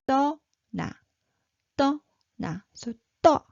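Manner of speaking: 떠 with a tight doubled t, pitched high; tense high tt. Shown as a demonstration. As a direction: pinch the tt of 떠나 and start high. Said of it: "So high pitched".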